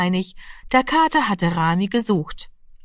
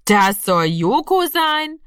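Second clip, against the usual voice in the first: slow, drawn-out doubtful question; zweifelnd; 6 semitones higher, slower than usual. A narrator's reading. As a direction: stretch it out and rise high in disbelief. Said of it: "zweifelte Horst". (ungläubig gedehnt, zweifelnd)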